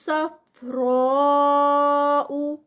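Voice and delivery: صفراء with heavy ص and را stretched four counts; slow chant; tajweed recitation. Shown as a demonstration. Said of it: "four counts کا stretch".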